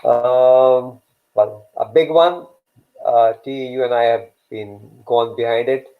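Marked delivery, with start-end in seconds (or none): none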